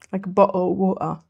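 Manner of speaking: quick British "bottle of water" with the T dropped; British accent. Shown as a demonstration. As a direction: drop the T in "bottle" and "water" like a Brit. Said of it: "I'm gonna go British".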